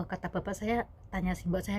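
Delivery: usual speaking voice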